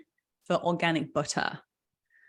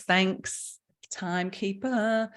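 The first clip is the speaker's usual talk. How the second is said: ordinary delivery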